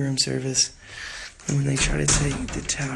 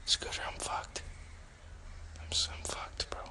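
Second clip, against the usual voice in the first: very soft, breathy whisper; hushed alarm; 13 dB quieter than usual. Real, not acted. Streamer confiding to viewer Scooter about trouble, hushed alarm. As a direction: whisper it as if security could hear.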